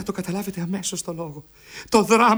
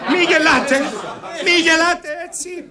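louder and higher, scolding; angry rebuke; 7 semitones higher, 8 dB louder than usual. Acted. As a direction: shout them down for laughing. Father rebukes the laughing woman. (θυμωμένη επίπληξη)

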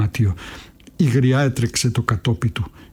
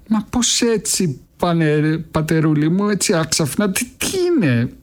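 put-on old woman flustered by his sudden tone, higher; 5 semitones higher than usual. A narrator's reading. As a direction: fuss over him in surprise. Old woman, taken aback.

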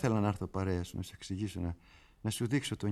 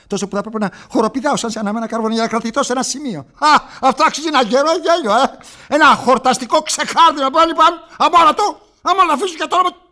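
high, loud, racing mania; manic mocking outburst; 15 dB louder, faster than usual. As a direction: spill it out in a shrill frenzy of glee. Manic monologue, mocking laughter. (μανιασμένο, κοροϊδευτικό ξέσπασμα)

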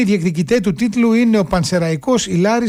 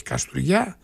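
softer "Καστουριά" in local dialect, ou for o; local Kastoria accent; 2 semitones lower, 7 dB quieter, slower than usual. Shown as a demonstration. Host correcting own dialect slip to listeners, local Kastoria accent. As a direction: echo "Καστουριά" softly in the local Greek accent. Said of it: "το είπα πολύ με προφορά, έτσι, ντόπιο λαλιάς".

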